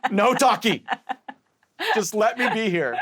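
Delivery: high, loud protest through laughter; overwhelmed refusal. Retold or imitated. Tripping patient begs the guide not to talk, high loud protest.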